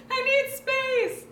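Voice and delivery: screamed very high and loud, slow, voice cracking; crying yell. Retold or imitated. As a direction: scream it through tears, at the end of your rope. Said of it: "I'm crying and yelling at her".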